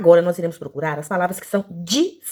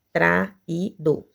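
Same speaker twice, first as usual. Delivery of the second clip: "Atraído" slowly split, a-tra-í-do; syllable by syllable; much slower than usual. Shown as a demonstration. Teacher testing for three syllables, "Atraído" slowly broken up. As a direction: separate every syllable of "Atraído". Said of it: "não".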